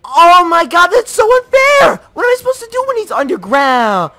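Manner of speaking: high, loud indignant shouting; shrill furious complaint; outraged yelling. Real, not acted. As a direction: rant at the unfair game. Unfair game boss, raging.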